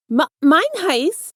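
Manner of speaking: high, slow stammered gasp of recognition; blurted out. A narrator's reading. Izuku startled to see the villain beside him, blurting high and slow. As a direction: stumble out his name in shock. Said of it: "he blurts out".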